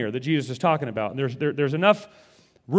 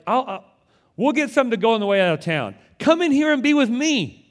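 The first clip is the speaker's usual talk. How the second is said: inviting Jesus; 7 semitones higher, 4 dB louder than usual. Retold or imitated. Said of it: "He's like".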